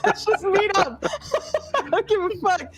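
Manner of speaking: high, laughing mock bravado; brash brawler. GM imitating the ranger who suddenly wants a fight, laughing. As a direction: swagger through the laughter, daring it to come. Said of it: "And then it's like".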